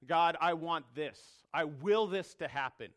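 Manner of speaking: I desire; firm will. Retold or imitated. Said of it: "that would be shocking".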